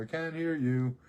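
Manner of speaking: loud call over the shoulder. Retold or imitated. Walking out the door, calling back loudly. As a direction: shout it while leaving. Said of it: "I'm walking out the door".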